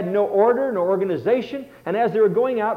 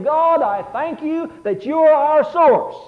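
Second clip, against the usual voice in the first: higher, louder, slow heartfelt thanks; earnest prayer; 6 semitones higher, 5 dB louder, slower than usual. Retold or imitated. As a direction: lift it up to God in grateful praise. Voicing a grateful prayer.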